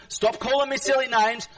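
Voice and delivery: usual speaking voice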